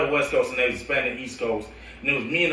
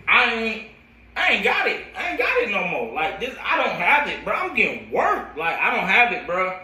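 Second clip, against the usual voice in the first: pitched up high and strained, near tears; retold breakdown; 7 semitones higher than usual. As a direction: relive the moment you told your friend you were done, voice cracking up high. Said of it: "like, crying, bro".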